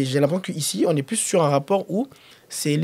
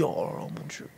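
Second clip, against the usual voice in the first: breathy hushed mock exclamation, much softer; mock gasp; 12 dB quieter than usual. Imagining meeting a star, hushed mock awe. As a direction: whisper it like a starstruck fan. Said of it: "tu te dis".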